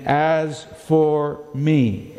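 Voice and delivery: slow, deliberate "As for me"; emphatic. Retold or imitated. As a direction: lean on each word with certainty. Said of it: "David is absolutely emphatic".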